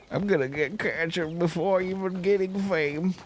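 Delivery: high, put-on comic voice; joking high-pitched put-on delivery; mock high voice. Repeats the joke in high voice.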